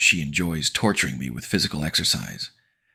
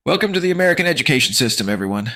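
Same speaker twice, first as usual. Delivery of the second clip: sarcastic announcer; 10 semitones higher, 7 dB louder, faster than usual. A narrator's reading.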